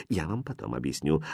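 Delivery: low, hushed aside; quiet reply. A narrator's reading. Governess putting off the princess's question, quietly. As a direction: keep it secret, very soft. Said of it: "так же тихо ответила".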